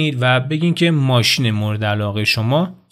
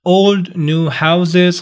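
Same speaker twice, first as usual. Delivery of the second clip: put-on "Old new houses" very slow and higher; slow vocabulary reading; 5 semitones higher, 4 dB louder, much slower than usual. Shown as a demonstration. Teacher reading textbook vocabulary aloud. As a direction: read each word slowly. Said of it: "reading vocabulary pairs".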